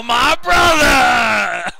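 slow stretched wrestler shout; impressionist. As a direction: bellow it long like Hulk Hogan. Hulk Hogan style shout.

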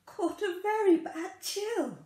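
ordinary delivery

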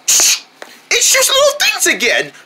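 squeaky high voice. Acted. Fixed machine shoots things again.

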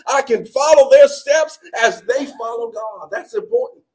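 loud, high, shouting sermon climax; fiery preaching. Acted. Pastor rallying the church to hold the banner high, shouted. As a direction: fight for it with your whole voice.

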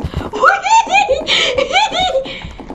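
very high, drawn-out giggle; real high-pitched laughter; gleeful squeal-laugh. Real, not acted. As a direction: squeal with delight. Gleeful play after finding holes.

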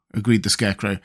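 usual speaking voice

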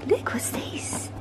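slow breathy whisper to puppy; whispered pet talk. Real, not acted. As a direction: whisper slowly to tempt the puppy. Teasing the puppy with toy.

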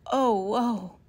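loud, startled cries; alarmed cry. A narrator's reading. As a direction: gasp out in alarm. Box torn from her.

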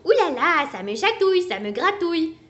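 playful taunt; teasing. Acted. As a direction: tease the sick koala while dancing. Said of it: "fit-il en dansant".

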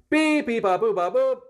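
high, loud sung beeps like a keypad; playful keypad beeps; mouth phone dial beeps. Pretend. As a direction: beep out the number like dialing. Dialing phone with mouth noises.

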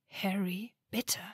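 pressed, held-back plea; understated strained appeal. A narrator's reading. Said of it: "rief Granger gepresst".

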